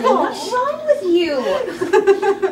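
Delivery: slow, high, laughing jab; laughing tease. Acted. Karen mocking Doc for believing her, slow laughing tease. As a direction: drag it out through a laugh.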